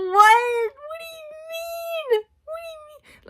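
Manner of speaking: very high, very slow wail of disbelief; crying disbelief. Real, not acted. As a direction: cry it out, pitch way up. Stunned by the twist.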